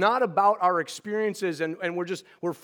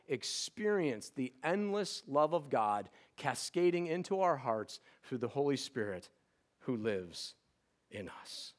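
fading reverent reading; 2 semitones lower, 6 dB quieter than usual. Acted. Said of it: "closing scripture reading".

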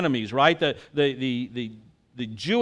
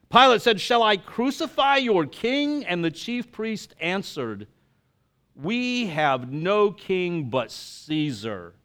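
dramatic Gospel reading from the pulpit; proclaiming the Gospel to the congregation; scripture reading; 2 semitones higher, 4 dB louder than usual. Acted. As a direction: read the Passion with feeling. Reads the Passion gospel aloud.

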